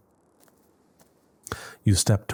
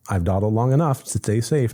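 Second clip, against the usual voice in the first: ordinary talk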